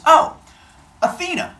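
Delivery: high, mocking oh Athena; sing-song taunt; taunting call. Arachne taunting the goddess with a high sing-song.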